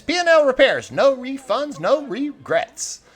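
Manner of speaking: high, loud salesman's slogan; sign read as slogan. Acted. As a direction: proclaim the shop sign, high and punchy. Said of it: "a sign hanging out front that says".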